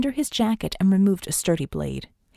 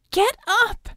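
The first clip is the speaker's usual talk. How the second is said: very high, loud yell; piercing high command; urgent shout; 13 semitones higher, 5 dB louder than usual. Acted. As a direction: shout to jolt someone awake. Vicky rousing Red from the floor.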